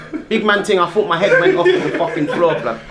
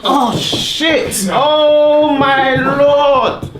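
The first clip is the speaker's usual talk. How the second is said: startled yelp; 8 semitones higher, 5 dB louder, much slower than usual. Real, not acted. Cracked during adjustment, yelps.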